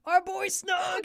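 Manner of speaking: high, loud cheer for Snug; gleeful cheer for a favourite character; excited shout. Real, not acted.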